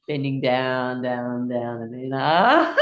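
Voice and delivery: low, drawn-out "down", swooping up on "ah"; low build into a rising "ah"; sinking then rising. Shown as a demonstration. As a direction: bend the voice down, then spring up. Leading bend down, spring up.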